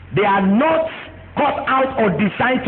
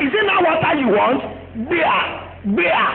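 higher pitched mocking jab; sarcastic angry prophet; 6 semitones higher than usual. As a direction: sneer it at the crowd. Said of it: "carried the stick and said".

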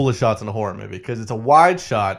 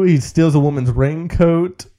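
ordinary delivery

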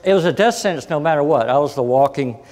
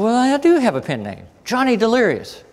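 proud announcement; 7 semitones higher than usual. Retold or imitated. Proudly telling publisher pen name.

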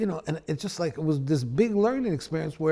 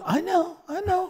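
a much higher, laughing "I know, I know". Retold or imitated. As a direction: chuckle it out as the teacher. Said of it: "He goes".

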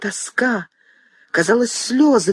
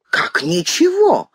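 sharp, much higher, drawn-out cry of shock; cry of disbelief; 10 semitones higher, slower than usual. A narrator's reading. Mother cries out in shock at her son's words, high and slow. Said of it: "вскрикнула Варвара Петровна".

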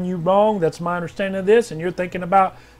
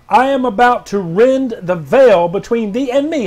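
lifted, louder declaration; prophetic proclamation; 3 semitones higher, 6 dB louder than usual. Acted. Pastor reading Janine's word, proclaiming. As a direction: announce it as God's promise.

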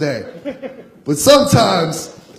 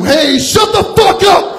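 slow furious shout, pitched way up; angry yell at heckler; 11 semitones higher, 3 dB louder, slower than usual. Retold or imitated. Comic yelling back at a heckler, furious shout. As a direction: blow up at the old man heckling you. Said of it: "lost my shit on this guy".

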